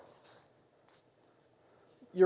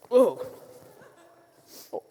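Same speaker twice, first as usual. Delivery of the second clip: usual speaking voice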